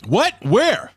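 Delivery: loud high yelp of surprise; shocked. Real, not acted. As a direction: jolt up in disbelief. Shocked at cheap donation.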